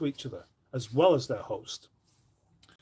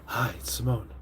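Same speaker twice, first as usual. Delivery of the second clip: impression of Simone; 5 semitones lower, 5 dB quieter than usual. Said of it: "I'm gonna do the impression of you".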